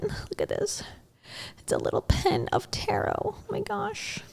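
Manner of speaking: soft, whispery aside; barely voiced, whispered delight; hushed showing-off. Real, not acted. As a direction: show off a little treasure in a near whisper. Showing off a pen to viewers.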